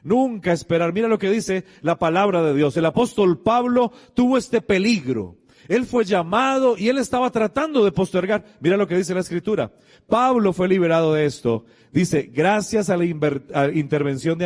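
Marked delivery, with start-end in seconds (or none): 0.0-10.7 high, emphatic sermon delivery; forceful preaching. Real, not acted. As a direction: proclaim the biblical warning to the congregation.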